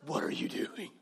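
angry parent's whisper. Retold or imitated. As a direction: hiss it at the kids in the back. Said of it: "you turn around and say".

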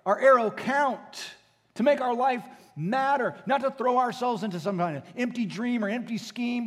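loud, high, driving sermon voice; full-force preaching. Acted. Pastor urging the congregation toward holiness, loud and impassioned. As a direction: preach it hard and rising to the room.

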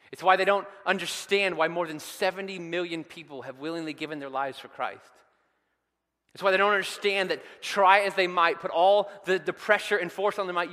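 loud, high, driving sermon build; preaching at full force. Acted. Preacher rousing the congregation about Saul, full force. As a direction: preach it out over the crowd.

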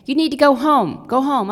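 loud, firm order to go home; husband ordering a young man home, loud and stern; stern command. Retold or imitated. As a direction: bark it like a stern counselor on the phone. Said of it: "and I hear him say".

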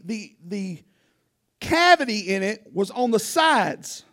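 normal talking voice

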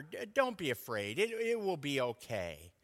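put-on soft, gentle parent reassuring a child; meek and mild. Shown as a demonstration. Parent soothing a little child at the doctor, soft and gentle. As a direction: coax it gently, like calming a scared child at the doctor. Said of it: "in kind of a meek and mild way".

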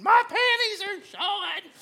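high, loud mock scream; screaming toddler. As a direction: shriek it like an outraged three-year-old. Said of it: "she was screaming".

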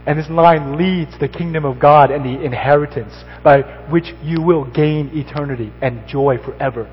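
loud, high, ringing preacher's proclamation; full-force preaching. Acted. Pastor urging Christians that Jesus is their lifeline, preached loud. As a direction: preach it out with full conviction to the whole room.

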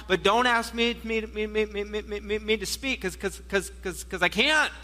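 fading, stuttering whine on me and cause; mock stutter. Preacher voicing Moses stammering to God, mock stutter.

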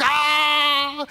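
long, high, loud "aah"; drawn-out imitated yell of agony; mock scream of pain. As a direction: act out screaming your head off. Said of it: "screaming my head off".

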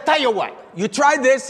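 high-pitched, forceful café owner impression; insistent café papa. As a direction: push the customer to take your offer. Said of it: "He said".

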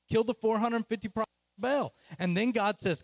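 ordinary talk, nothing marked

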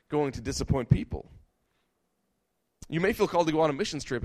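ordinary delivery

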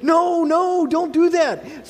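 put-on high, slow begging child voice; panicked kid pleading. Son begging his dad not to tell church, high slow pleading. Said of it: "And he's like".

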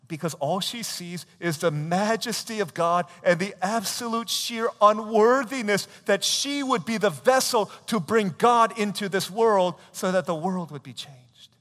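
loud, high, slow preaching; full-voiced sermon fervor; impassioned preaching. Real, not acted. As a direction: drive each phrase home from the pulpit.

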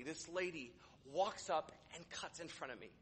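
ordinary delivery

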